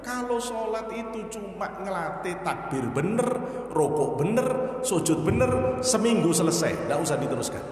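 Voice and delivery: booming pulpit voice, loud and driving; fiery preaching. Acted. Preacher thunders at the congregation about empty prayer. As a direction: hammer the point home to the crowd.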